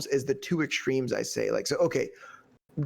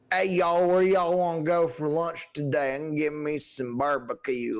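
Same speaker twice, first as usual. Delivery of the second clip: put-on hillbilly Southern accent; 2 semitones higher than usual. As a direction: lay the Southern accent on thick. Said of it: "when she does a Southern accent".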